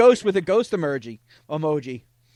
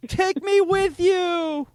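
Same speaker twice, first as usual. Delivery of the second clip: high, slow, hammed-up plea; mock pleading; 11 semitones higher, 3 dB louder, much slower than usual. He begs the woman to take him to bed, high and drawn out. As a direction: whine it out slowly like a needy lover.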